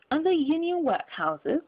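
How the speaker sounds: lightly voiced miser demanding; Scrooge demanding. Scrooge demands of the gentleman, mild scorn. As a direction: press the question, a touch scornful. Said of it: "demanded Scrooge".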